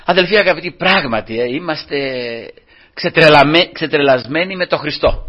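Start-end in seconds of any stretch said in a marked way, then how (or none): none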